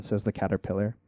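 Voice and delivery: plain reading voice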